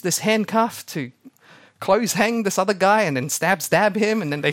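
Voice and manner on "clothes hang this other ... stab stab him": usual speaking voice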